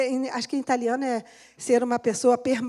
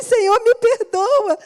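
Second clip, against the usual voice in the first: higher, louder, slow pleading cry; imitating a tearful plea; 9 semitones higher, 9 dB louder, slower than usual. Woman reliving her tearful plea to God at communion. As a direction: beg for forgiveness as if in tears, slow. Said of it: "Aí eu comecei a chorar".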